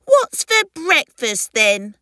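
high, loud little creature question; hungry hatchling. Acted. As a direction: demand food in a perky baby character voice. Hungry baby asks for food.

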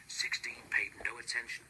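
usual speaking voice

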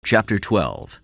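ordinary delivery, nothing marked

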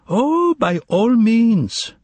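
high, long-drawn effusive consent; delighted exclamation. A narrator's reading. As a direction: exclaim it expansively, stretching each word high. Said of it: "exclaimed the stranger".

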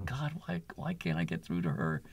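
soft, breathy whisper to God; retold whispered prayer. Kneeling in his bedroom, asking God why, whispering. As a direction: hush it like a private prayer.

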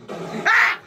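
sharp frightened yelp; startled yelp. Real, not acted. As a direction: yelp at the jump scare. Jump scare reaction.

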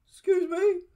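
high tiny creature voice; a small squeaky voice from the dark; squeaky bat voice. Acted. Mystery voice in dark cave.

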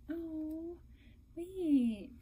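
ordinary talk

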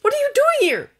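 very high, loud disbelieving question; can't believe he's here; astonished. A narrator's reading. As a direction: Draco stunned by Victor's arrival. Draco stunned by visitor.

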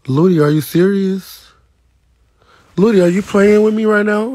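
calls out in shock, pitch climbing; surprised. Real, not acted. Reading a viewer's comment, shocked and loud.

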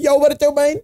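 high-pitched mock dude greeting; puppet character voice. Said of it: "He's like".

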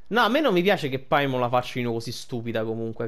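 ordinary talk

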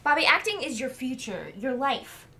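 heated argument. Acted. Partner pleading his career against leaving, heated. Quarrel over quitting the show.

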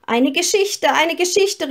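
put-on children calling out. A narrator's reading. Children begging the guest for a story, calling out high. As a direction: call it out like eager children. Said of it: "riefen die Kinder".

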